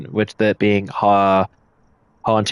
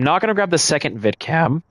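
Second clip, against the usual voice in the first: voice crack; 6 semitones higher than usual. Real, not acted. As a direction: voice cracking mid-sentence. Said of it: "Excuse that voice crack".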